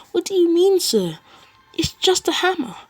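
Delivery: high, loud acted disbelief; incredulous exclamation. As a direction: protest shrilly, as if baffled. Mirio reacts in disbelief to All Might.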